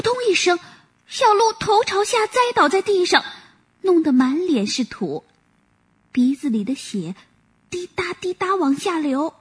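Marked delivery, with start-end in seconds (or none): none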